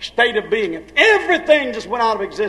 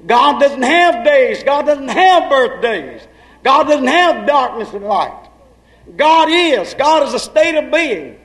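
preaching at full force; 5 semitones higher, slower than usual. Acted. Preacher booming to his congregation, full force. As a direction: proclaim it to the whole church.

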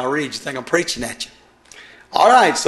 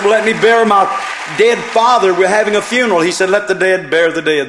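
much louder and higher, hammering each line; shouted preaching; 5 semitones higher, 6 dB louder than usual. Acted. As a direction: preach it out over the crowd. Preacher at full force.

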